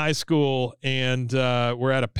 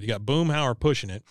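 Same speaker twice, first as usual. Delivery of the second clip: ordinary delivery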